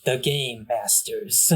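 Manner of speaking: slow, loud title announcement; announcer. Acted. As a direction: announce it like an outro.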